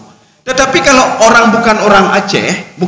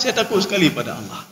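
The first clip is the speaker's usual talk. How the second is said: much softer "Karena saya takut sekali pada Allah"; pious answer; 11 dB quieter than usual. Pretend. Preacher voicing a believer's answer, much softer. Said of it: "Kenapa tidak berbuat maksiat".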